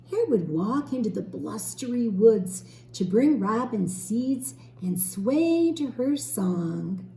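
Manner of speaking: put-on lively storybook reading for children; teacher reading a story to young children, gently expressive; story narration. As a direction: read it like a picture book aloud to a class.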